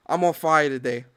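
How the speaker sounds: ordinary delivery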